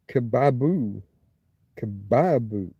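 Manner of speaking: "kebabu" as kuh-BAB-oo, falling; stress on middle syllable. Shown as a demonstration. Streamer trying the Akkadian word, middle-syllable stress. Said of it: "Gotta put that stress on that middle syllable".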